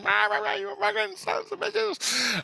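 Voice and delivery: squeaky high jeers, stretched at the end; mock yelling kid. Storyteller mimics kid as the rock falls, high mock jeering. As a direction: imitate a kid squealing insults. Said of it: "He's yelling".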